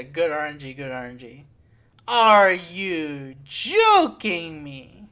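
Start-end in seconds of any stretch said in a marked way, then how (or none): 2.1-5.1 very slow, loud drawn-out disbelief; incredulous outrage. Real, not acted. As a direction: stretch each word loudly in outrage. Disbelief at bad luck.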